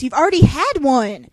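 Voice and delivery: loud exasperated outburst; indignant shout. Real, not acted. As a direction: shout at the book in disbelief.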